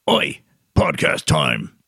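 low, loud, slow mock punk Cockney; punk way of saying it. Host tries punk voice to launch the podcast. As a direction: bark it like a punk. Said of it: "is that a punk way of saying it".

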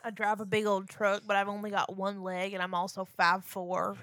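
put-on rural Florida accent, like Ruthie from Ozark. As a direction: play a backwoods Florida trucker. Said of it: "You sound like Ruthie from Ozark".

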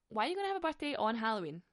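usual speaking voice

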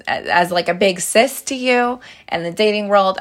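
ordinary talk

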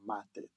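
ma tête, said slowly in French; French for my head. Shown as a demonstration. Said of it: "we say".

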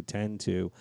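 ordinary delivery

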